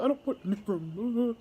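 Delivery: mock-upset guest in a put-on voice; upset foreign man. Retelling the guest refusing another room, mock-upset voice. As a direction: imitate an angry guest's protest, half in fun. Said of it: "He upset".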